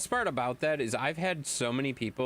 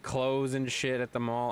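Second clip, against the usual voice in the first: ordinary talk, nothing marked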